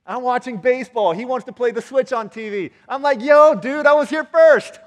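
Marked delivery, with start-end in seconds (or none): 3.2-4.8 very high, very loud protest; argumentative shout. Retold or imitated. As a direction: stake your claim in a comic squabble. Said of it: "I got into an argument".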